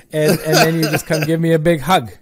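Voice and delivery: ordinary talk, nothing marked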